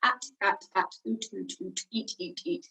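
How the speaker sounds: "at", "oot", "eat" each ending on a released t; final consonant drill. Shown as a demonstration. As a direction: run through the vowels softly, keeping every final t. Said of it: "it's at the end of a word".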